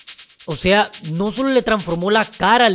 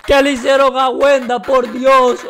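distressed cry; 3 semitones higher, 4 dB louder than usual. Real, not acted. Finds his favourite character mutated.

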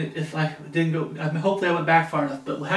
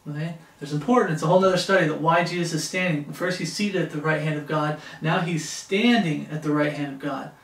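usual speaking voice

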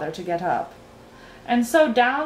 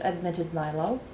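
ordinary delivery

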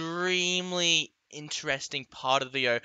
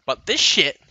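ordinary delivery

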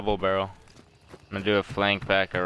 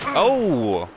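surprised; 8 semitones higher, 6 dB louder than usual. Real, not acted. Spotting an enemy.

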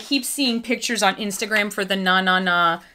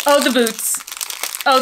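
usual speaking voice

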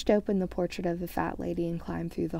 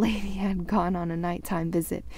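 plain reading voice

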